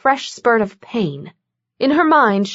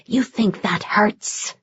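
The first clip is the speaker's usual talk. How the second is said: a demon hissing his threat; slow menacing demon hiss; 4 dB quieter, slower than usual. A narrator's reading. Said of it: "Rom hissed".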